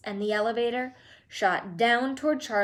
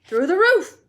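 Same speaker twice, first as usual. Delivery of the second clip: higher and much louder; triumphant; 9 semitones higher, 10 dB louder than usual. A narrator's reading. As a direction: announce it proudly. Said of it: "Wonka's triumphant answer".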